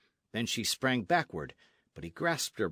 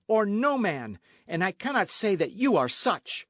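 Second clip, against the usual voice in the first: high, loud, emphatic tirade; outraged cry; 8 semitones higher, 6 dB louder than usual. A narrator's reading. Señorita refuses to wed the captain, crying out angrily. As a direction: reject him with mounting scorn. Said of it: "she cried".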